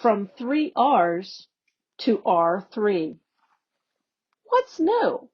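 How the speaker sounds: normal talking voice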